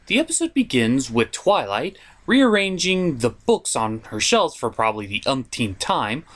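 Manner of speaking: ordinary talk, nothing marked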